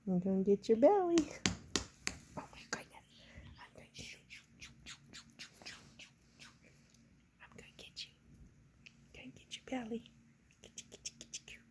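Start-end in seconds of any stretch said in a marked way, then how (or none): 2.4-11.6 hushed playful whisper. Real, not acted. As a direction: whisper like sneaking up to pounce. Playful whisper stalking the pet.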